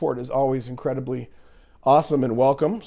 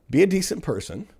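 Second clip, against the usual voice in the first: normal talking voice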